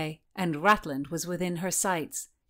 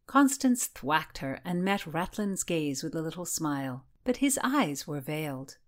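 ordinary delivery, nothing marked

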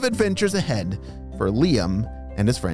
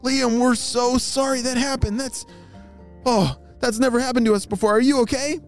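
higher, quick, sorry friend; apologetic friend voice; 12 semitones higher than usual. Acted. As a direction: say sorry in a raised voice.

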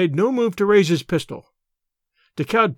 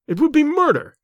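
much higher-pitched protest; appalled protest; 9 semitones higher than usual. A narrator's reading. Decaud refusing to shoot Tarzan, appalled. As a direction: refuse in shock, pitch raised.

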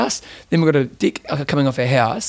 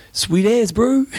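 laid-back broad kiwi accent, rising; casual New Zealand drawl on bro; 6 semitones higher, much slower than usual. Shown as a demonstration. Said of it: "Stock standard answer for any question".

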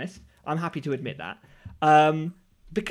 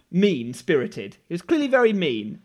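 normal talking voice

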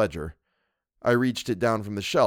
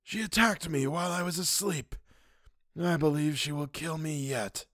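put-on a wounded old man, weak and bitter; Mister Wilde; 7 semitones higher, 6 dB quieter than usual. A narrator's reading. Wilde complaining to the narrator, high and hushed. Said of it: "he said".

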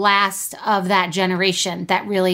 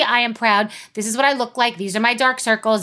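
normal talking voice

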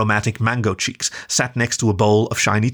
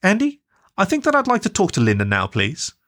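mother's higher, polite but firm voice; calm mum; 10 semitones higher than usual. Acted. Mum asking dad to hand over the phone, polite and firm. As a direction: politely take the call back.

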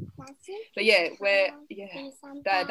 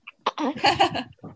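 high, loud burst of laughter; real laugh; 9 semitones higher, 6 dB louder than usual. Real, not acted. Podcast guest amused mid-interview, laughing out loud.

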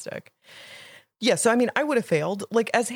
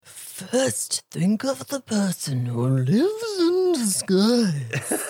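Sphinx voice; 4 dB louder, slower than usual. Pretend. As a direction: recite it like a grand mythical beast. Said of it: "your best Sphinx voice saying this riddle".

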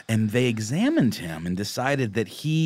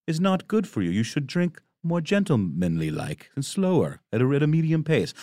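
a hint of a stuffy gentleman lecturing; mock posh gentleman; 5 semitones higher than usual. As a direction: play a prim gent advising restraint. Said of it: "that just sounds like you're saying".

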